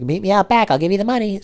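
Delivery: high mock lady voice; pit bull owner. Lady directing the pizza driver out back, imitated high voice. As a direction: impersonate a woman calling out instructions. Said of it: "this lady had a pit bull".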